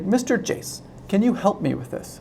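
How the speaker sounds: lightly voiced, tentative plea to a teacher; retold plea for help. Student asks teacher for help, retold.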